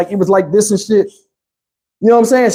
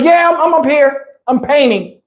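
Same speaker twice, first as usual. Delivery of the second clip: high imitated woman's call; mimicking her, pitched up; 7 semitones higher than usual. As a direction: call down from upstairs in a higher voice. Imitating woman calling from upstairs.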